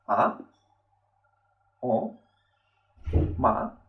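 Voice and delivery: "a", "o", "ma" each rising from low; second tone. Shown as a demonstration. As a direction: lift "a", "o", "ma" like questions. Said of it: "pronunciar el segundo tono".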